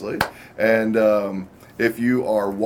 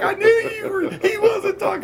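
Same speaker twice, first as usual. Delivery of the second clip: much higher, laughing through the words; laughing retold quote; 4 dB louder than usual. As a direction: squeal it out while cracking up. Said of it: "she's like".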